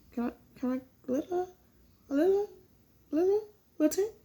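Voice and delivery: put-on high, soft, baby-ish plea for a tip; cutesy begging. Vlogger begging viewers for a tip, cutesy and soft. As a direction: coo it like a shy child asking for a favour.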